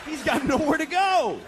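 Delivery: high mocking shout. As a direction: yell it out in mock pity. Stand-up mocking a hiding husband.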